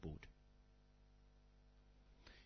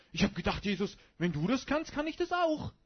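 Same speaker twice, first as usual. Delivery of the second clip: mock Peter boast; 5 semitones higher, 6 dB louder than usual. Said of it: "sagt er".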